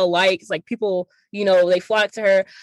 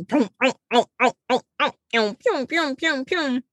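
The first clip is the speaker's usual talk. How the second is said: Pac-Man chomping; 5 semitones higher than usual. Retold or imitated. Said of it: "she, like, starts".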